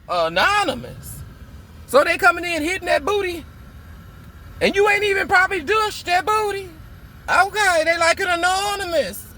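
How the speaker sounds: high, sassy incredulous questions; mocking disbelief. Telling the audience about the ex's masked hookups, high and mocking. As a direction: scoff at his excuse in disbelief.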